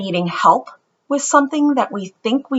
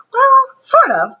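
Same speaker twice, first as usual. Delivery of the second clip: hesitant mom; 6 dB louder, slower than usual. Acted.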